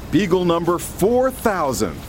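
ordinary talk

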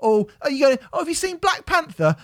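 high, bright mocking asker; mock high question. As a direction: pitch it way up as the friend asking. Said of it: "mock dialogue question".

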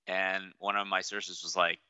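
ordinary talk, nothing marked

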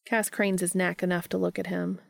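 ordinary delivery

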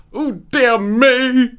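high, drawn-out mock dismay; hammed-up scandalized wail, stretched slowly; mock horror. Exaggerated mock shock, escalating.

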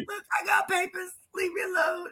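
high, whiny, crying-voiced plea; mock crying plea. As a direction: whimper it like a mock sob. Retelling crying plea to people.

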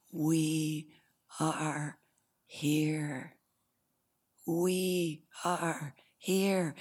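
slow, each word set apart; grounded collective declaration. Retold or imitated. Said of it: "Speak from that".

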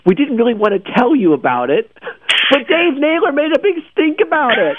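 high, hammed-up guilty confession; mock sheepish Google. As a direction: play the embarrassed company owning up.

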